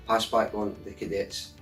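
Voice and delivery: usual speaking voice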